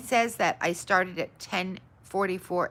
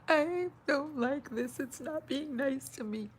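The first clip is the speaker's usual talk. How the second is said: mock whine; 9 semitones higher, slower than usual. Real, not acted. Whining at broken stream software.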